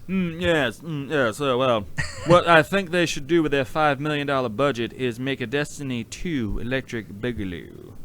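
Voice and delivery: drawling rich Southern gent; lightly voiced Southern tycoon; rich Southerner voice. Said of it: "Do we have to talk in the fancy rich voice".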